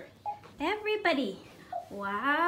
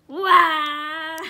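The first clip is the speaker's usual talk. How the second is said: loud scare; 4 semitones lower, 12 dB louder than usual. Pretend. Jumps to scare child.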